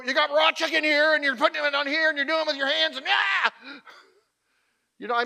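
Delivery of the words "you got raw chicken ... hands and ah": high, loud berating that ends in a cry; exasperated chef rant. Acted. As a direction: rant at the cook, breaking off in a yell. Acting out an angry show chef.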